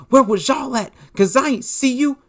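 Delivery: high, mocking put-on voice; sarcastic high mimicry of a rival. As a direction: throw his own words back at him. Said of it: "You literally said".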